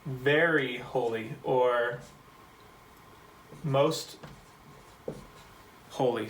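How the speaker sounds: "very holy" drawn out slowly, pausing before "most"; slow careful translation. Shown as a demonstration. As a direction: lay out each option slowly with gaps. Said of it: "we actually translate it as".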